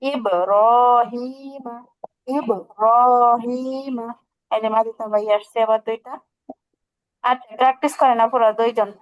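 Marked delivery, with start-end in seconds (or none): 0.0-4.1 ইব, রহিমা stretched very slowly with long vowels; drawn-out recitation. Shown as a demonstration. Teacher reciting the example so students hear the madd, very slow. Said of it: "মাদের হরফও চলে আসছে".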